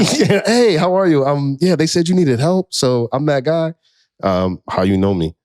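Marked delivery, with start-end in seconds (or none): 0.4-3.7 higher-pitched mock introduction; imitating his own outreach pitch; awkward doorstep greeting. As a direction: act out a tentative cold call at the door.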